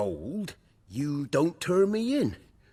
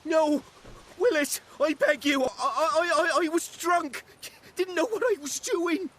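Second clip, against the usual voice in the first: desperate begging. Acted. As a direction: beg for your life, voice cracking up high. Arsonist begs the blacksmith.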